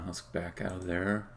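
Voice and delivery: ordinary delivery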